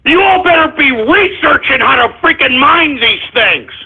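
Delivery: ordinary delivery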